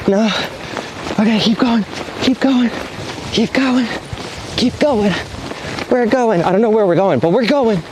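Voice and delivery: high-pitched, breathless cheering as the wing rises; thrilled. Real, not acted. To himself as the wing finally lifts: high excited cheering. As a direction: celebrate every second the wing stays up. Running under the lifted wing.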